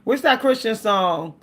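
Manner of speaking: ordinary delivery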